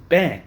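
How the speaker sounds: a sharp, loud, mimed bang; punch sound effect. Pretend. Ranting patient mimes punching a lazy doctor, loud bang. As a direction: smack the word out like a punch landing.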